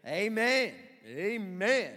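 loud drawn amen; amen call. Real, not acted.

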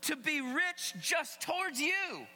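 high, forceful preacher speaking as God; emphatic divine rebuke, punching the key words; voicing God. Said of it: "He's saying this".

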